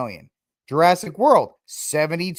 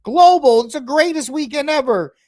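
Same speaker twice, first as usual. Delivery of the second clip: high, loud hyped-up fan gush; mock excited gushing; 11 semitones higher, 7 dB louder than usual. Everybody hyping the box office, high excited gush. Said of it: "So everybody's like".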